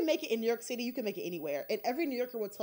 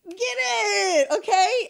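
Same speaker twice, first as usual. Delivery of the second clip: loud, high, triumphant whoop; hyped self-cheer; 10 semitones higher, 10 dB louder, much slower than usual. Retold or imitated. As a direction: cheer yourself on at full volume. Retold self-cheer on first paid engagement.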